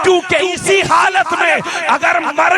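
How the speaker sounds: fiery preaching. Acted. Preacher urging the congregation to keep faith, shouting. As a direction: build to a full-throated sermon climax.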